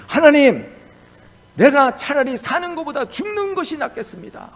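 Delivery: high, loud cry of anger at God; Jonah's angry outburst. Retold or imitated. Jonah angrily telling God he would rather die, loud high cry. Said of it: "막 그러고 화를 냈어요".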